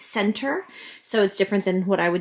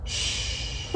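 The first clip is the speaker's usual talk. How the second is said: soft breathy shush; whispered shh; 8 dB quieter than usual. Shown as a demonstration. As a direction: hush the room gently. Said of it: "Can you say".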